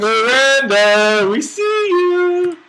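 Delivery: slow, drawn-out creepy call, rising high on you; a spooky stalker teasing the new mod. Pretend. Teasing the hidden moderator Miranda with a slow creepy call. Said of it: "That is creepy".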